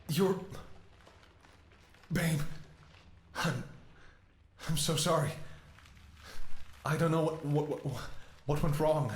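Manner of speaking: high, strained near-sobbing voice; begging forgiveness through tears; tearful apology. Acted. Apologising to dying partner.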